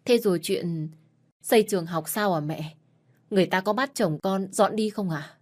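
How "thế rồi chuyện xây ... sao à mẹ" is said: ordinary delivery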